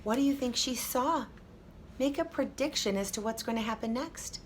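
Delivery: ordinary delivery, nothing marked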